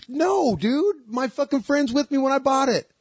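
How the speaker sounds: high, squeaky outraged protest; indignant guy. Retold or imitated. Guy arguing with the woman over the shirt, high outraged voice.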